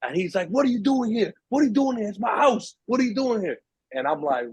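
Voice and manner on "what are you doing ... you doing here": high, pushy yelling of the thief's demand; aggressive thief. Retold or imitated. Thief shouting at the intruder in his house, high and aggressive. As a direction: bark it like a kid guarding his turf. Thief confronts him with pipe.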